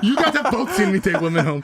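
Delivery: laughing. Real, not acted. Defensive laughing.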